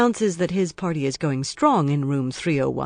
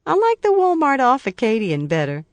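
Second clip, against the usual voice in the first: lightly voiced cheerful woman; 8 semitones higher, 6 dB louder than usual. A narrator's reading. Woman chatting, laughs after.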